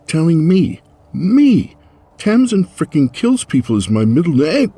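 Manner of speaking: high, loud, incredulous protest; indignant outburst. Acted. Tamsin scoffing at Finn's advice, high and loud. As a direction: squeal it in outraged disbelief.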